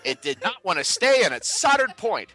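put-on sing-song old-time radio patter; 1920s guy voice. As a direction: do a hammed-up Roaring Twenties storyteller. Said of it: "where's nineteen twenties [name]?".

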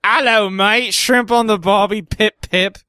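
put-on hammy British accent, a bit Australian; mock English accent. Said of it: "that sounds more Australian".

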